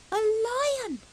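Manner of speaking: high, loud startled yelp; frightened cry. Acted. Fuzzy Peg seeing a dog he thinks is a lion, high and terrified. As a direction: yelp in terror at the beast.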